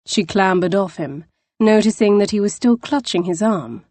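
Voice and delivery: ordinary delivery, nothing marked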